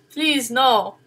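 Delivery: ordinary talk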